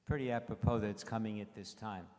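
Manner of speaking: usual speaking voice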